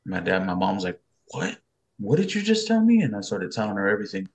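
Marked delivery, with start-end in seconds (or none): 1.3-3.0 high, loud incredulous demand; raised-pitch stunned questioning; shocked disbelief. Retold or imitated. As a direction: imitate a mom who can't believe her ears. Mother shocked by late-night news.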